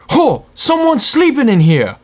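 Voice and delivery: high, alarmed cry of warning; mock-panicked high yell; retold alarm. Retold alarm at finding sleeper.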